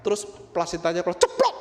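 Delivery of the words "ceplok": loud, high imitated plop; bunyi ceplok kencang. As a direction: burst out the plop as a sound effect. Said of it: "Kenceng banget gitu". (tiruan bunyi jatuh, keras dan tinggi)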